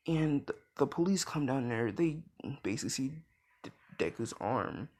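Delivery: plain reading voice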